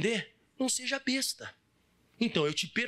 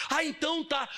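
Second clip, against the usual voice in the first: glib reply; 9 semitones higher than usual. Acted. Acts the forgiven person's reply.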